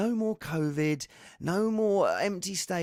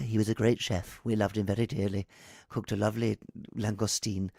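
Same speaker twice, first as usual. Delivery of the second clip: lightly voiced solemn obituary; mock eulogy; 5 semitones lower than usual. Host joking that guest's photo looks like an obituary, mock-solemn. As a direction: read it like a mock funeral notice. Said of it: "looks like he's either just died".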